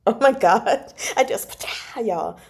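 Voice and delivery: usual speaking voice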